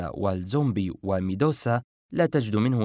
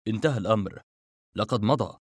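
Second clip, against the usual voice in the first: usual speaking voice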